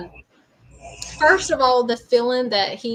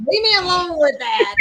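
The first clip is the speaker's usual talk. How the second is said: put-on grumpy old grandpa; 8 semitones higher, 4 dB louder than usual. Grandpa voiced refusing help.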